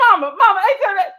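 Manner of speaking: high, loud call across the kitchen. Retold or imitated. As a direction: call mama to get her attention. Calling to grandmother.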